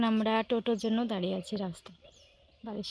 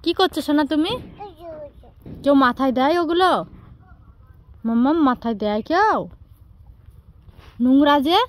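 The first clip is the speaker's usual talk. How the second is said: scolding; 6 semitones higher, 10 dB louder than usual. Real, not acted. Scolding baby for mess.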